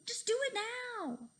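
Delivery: high, loud frantic scream. A narrator's reading. Fluffy urging Cheeseball while falling, high loud scream. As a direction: scream it at him to hurry. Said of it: "screamed Fluffy".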